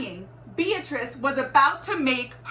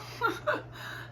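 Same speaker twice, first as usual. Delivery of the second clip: giggle; 12 semitones higher, 9 dB quieter than usual. A narrator's reading. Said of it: "Beatrice let out a giggle".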